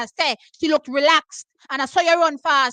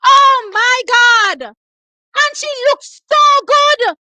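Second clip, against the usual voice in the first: slow, drawn-out ecstatic yell, much higher and louder; excited screaming; 8 semitones higher, 8 dB louder, much slower than usual. Real, not acted. Fan watching the race screams in delight. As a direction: scream with joy at the finish.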